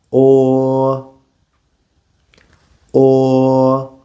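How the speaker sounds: "Or" with lips slightly rounded; "Or" rounded, like in English or; Mandarin o vowel. Shown as a demonstration.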